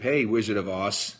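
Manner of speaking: ordinary talk, nothing marked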